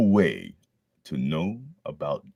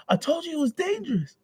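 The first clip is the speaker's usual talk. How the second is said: mother, lighter; faster than usual. Acted. Bakugo's mom scolding, lighter falsetto repeat. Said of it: "that was Bakugo's mom".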